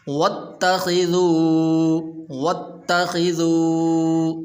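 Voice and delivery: slow sung tajweed recitation; Quran recitation. Shown as a demonstration. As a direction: chant each word long and melodic. (ترتیل کے ساتھ تلاوت)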